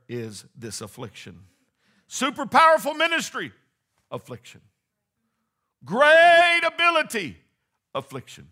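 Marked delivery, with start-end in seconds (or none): 2.1-3.5 high, loud proclamation; preaching shout. Real, not acted. Rising contrast in preaching. 5.8-7.4 high, loud proclamation; preaching shout. Real, not acted. Rising contrast in preaching.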